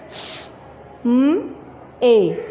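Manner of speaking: स, म, ए sounded out one letter at a time; letter by letter for the class; spelling out letters. Shown as a demonstration. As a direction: break समय into its letters slowly. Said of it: "समय".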